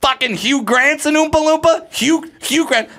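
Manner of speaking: high indignant yelling; mock outrage. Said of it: "mock outrage at the casting".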